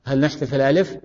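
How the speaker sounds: usual speaking voice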